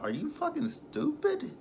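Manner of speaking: high, slow, disbelieving; mock-stunned at her choice; incredulous retort. Reviewer scoffing at Cheryl on the show. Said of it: "I'm like".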